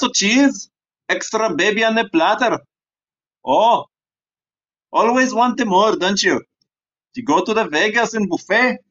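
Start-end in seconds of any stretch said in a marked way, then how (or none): none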